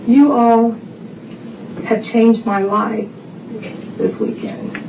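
slow, deliberate phrases with long pauses; measured quote, trailing to a hush; very distinct words. Retold or imitated. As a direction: retell it weightily, letting each phrase stand alone. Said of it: "the cab driver was very distinct in his words".

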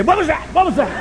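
high, sudden alarmed questions; mock alarmed teen; startled tripping son. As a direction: jump at a vision.

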